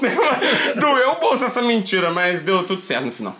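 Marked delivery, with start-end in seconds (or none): 0.0-2.1 laughing. Real, not acted. Rindo da própria mentira.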